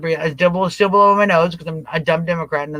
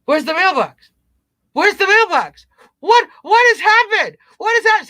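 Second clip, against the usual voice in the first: high, rising panicked cry; hammed-up panic, much higher; mock panic; 11 semitones higher, 4 dB louder than usual. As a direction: play a voter losing it over a missing mailbox.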